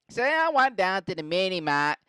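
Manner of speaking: mock Boston, MINI MART with no R; butchered Boston accent. Mark telling police where he went, butchered Boston accent. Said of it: "say it the most butchered way possible".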